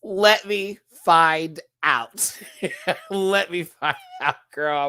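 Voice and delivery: slow, high, broken by laughter; laughing mock threat. Real, not acted. Host mock-threatening Viv, laughing. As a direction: draw it out through laughs.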